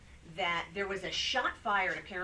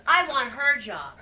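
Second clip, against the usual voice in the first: envious joke; 6 semitones higher, 13 dB louder, slower than usual. Retold or imitated. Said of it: "He goes".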